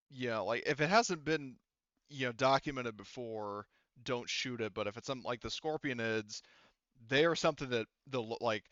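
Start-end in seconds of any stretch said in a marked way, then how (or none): none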